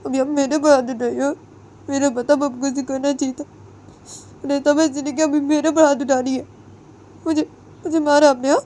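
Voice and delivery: put-on high, aggrieved young woman's voice; hurt daughter-in-law. Daughter-in-law reproaches mother-in-law, high and hurt. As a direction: reproach her for raising a hand.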